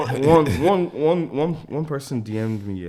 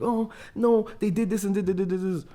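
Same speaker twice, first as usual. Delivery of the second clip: mock whiny complaint; 10 semitones higher than usual. Mocking emotional complaint.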